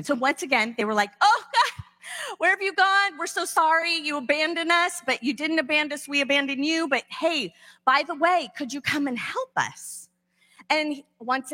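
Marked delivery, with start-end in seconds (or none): 1.2-10.1 high, pleading mock whine; whiny Israelites. Israelites begging God for help again, in a high mock whine. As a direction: beg God sheepishly, hammed up.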